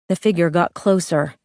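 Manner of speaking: ordinary delivery, nothing marked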